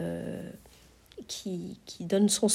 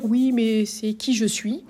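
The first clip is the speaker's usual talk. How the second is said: slow, doubting objection; mock objector; slower than usual. A sceptic's slow objection to the teacher. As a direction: voice a sceptic's reply. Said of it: "on peut dire".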